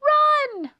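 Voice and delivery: very high, loud squeal; panicked mouse shriek; crying out in alarm. A narrator's reading. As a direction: shriek a warning to flee. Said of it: "cried Melissa".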